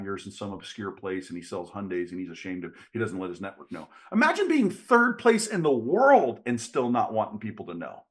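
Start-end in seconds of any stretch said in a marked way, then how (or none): 4.1-8.0 emphatic disbelief. Real, not acted. Emphatic point to Glenn.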